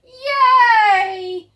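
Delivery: high, loud yay; cheer. Acted. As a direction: cheer with the crowd. Crowd cheering.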